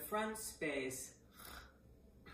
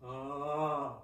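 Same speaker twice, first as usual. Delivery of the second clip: lower, back-placed "oh"; yawny oh; 4 semitones lower than usual. Shown as a demonstration. Voice teacher guiding into yawn space, low yawny oh. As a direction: sound into a yawn. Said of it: "Sound into that yawn space".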